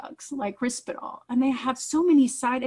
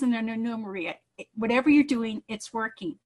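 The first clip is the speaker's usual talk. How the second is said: ordinary talk, nothing marked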